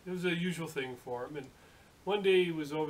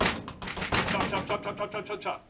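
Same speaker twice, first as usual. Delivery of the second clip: higher, punchy staccato burst; rapid chopping; 6 semitones higher, 5 dB louder, slower than usual. Acted. Storyteller acting out razor hacking salami, rapid chopping. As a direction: fire the words off like blade strokes.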